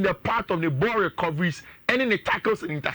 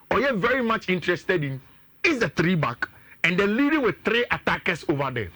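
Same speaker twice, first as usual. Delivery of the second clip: ordinary talk, nothing marked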